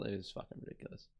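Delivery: breathy muttered disbelief under his breath; whispered disgust. Real, not acted. A viewer reading headlines, whispering his disgust to himself. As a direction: hiss it to himself, barely voiced.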